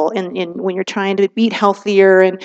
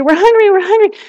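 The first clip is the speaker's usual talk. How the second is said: much higher mock kid whine; screaming kids; 11 semitones higher, 3 dB louder than usual. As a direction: nag like a hungry child in the van. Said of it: "the kids are screaming at you".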